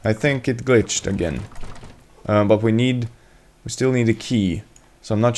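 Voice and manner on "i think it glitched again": ordinary talk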